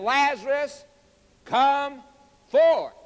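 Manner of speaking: high, emphatic call, loud on the final word; commanding call. Retold or imitated. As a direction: summon with force, word by word. Emphatic re-enactment of Jesus calling.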